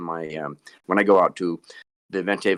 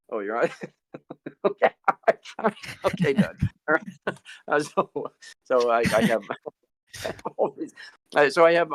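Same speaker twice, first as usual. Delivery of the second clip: normal talking voice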